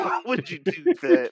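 high laughing question. Real, not acted. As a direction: laugh through the words. Laughing repeat.

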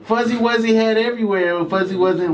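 giggling through a failed tongue twister; laughing. Real, not acted.

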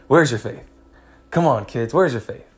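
Jesus scolding the disciples, sharp and loud; scolding rebuke. Acted. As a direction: snap at them like a fed-up teacher.

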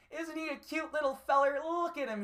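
doting cooing at a tiny man he lifted; baby talk to a little guy. Pretend. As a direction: coo over him like a cute pet. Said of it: "pick you up and go".

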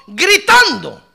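high, loud preacher's shout; sudden high, punchy cry. Acted. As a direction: shout the word as a demonstration.

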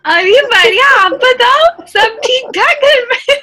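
high, loud gossiping auntie; pushy matchmaker auntie. Pretend. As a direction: chirp like an auntie catching up. Auntie phone chat role play.

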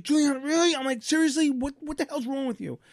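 irritated dad snapping at Juliana; snapping at daughter. Retold or imitated. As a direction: blow up at your kid for knocking the laptop. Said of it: "That wasn't necessary".

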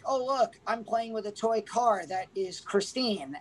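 usual speaking voice

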